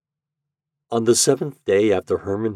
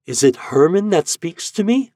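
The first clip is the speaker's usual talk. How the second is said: crying out; 9 semitones higher, 5 dB louder than usual. A narrator's reading. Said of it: "cried Eloise".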